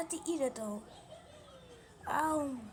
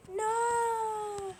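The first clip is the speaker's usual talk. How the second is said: pained shout; 5 semitones higher, 6 dB louder, much slower than usual. Acted. Character cries out in pain.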